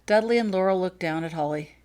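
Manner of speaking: ordinary delivery, nothing marked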